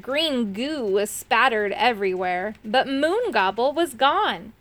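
ordinary delivery

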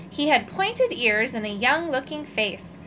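plain reading voice

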